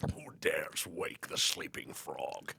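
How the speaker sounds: slow, whispered undead king; ancient rasping undead king. Pretend. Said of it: "Here he goes again".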